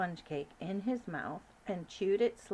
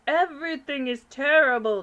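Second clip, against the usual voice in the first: loud, distressed foreign king; panicking king; 7 semitones higher, 11 dB louder than usual. Acted. King of Sweden on the phone, loud and panicked. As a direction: cry out in despair.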